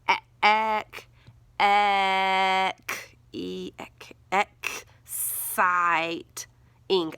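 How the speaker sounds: breaking "exciting" into pieces: e-, ec-, excite-, ing; sounding it out. Shown as a demonstration. Teacher sounding out a word for the class, slowly. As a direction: stretch out each chunk of "exciting" as you spell it. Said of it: "I N G on the end of the word".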